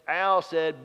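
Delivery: ordinary delivery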